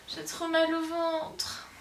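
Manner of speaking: slow, soft, high whimper; acted pained whine. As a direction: whine it weakly through belly cramps. Acted belly pain in skit.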